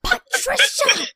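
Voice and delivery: loud, very high mimicked shriek; mock outraged cry. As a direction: echo the melodramatic cry in a high shout. Player mimics Karen's outraged cry.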